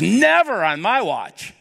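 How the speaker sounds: high, slow, forceful protest; emphatic refusal. Retold or imitated. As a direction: Peter digging in his heels. Peter refusing Jesus' suffering.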